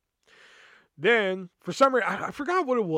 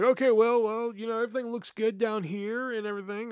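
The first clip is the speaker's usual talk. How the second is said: higher-voiced, tentative imitated boss; careful not to pry; 6 semitones higher than usual. David sensing trouble at the kiosk, tentatively upbeat. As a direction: keep it light and tread carefully. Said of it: "he didn't wanna pry too much".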